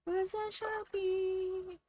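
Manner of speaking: sing-song silly voice. Pretend. Drawn-out silly voice on Sharpie.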